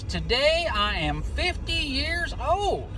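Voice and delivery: imitating a pleased, giddy woman; retold giddiness. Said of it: "she smiled all giddy".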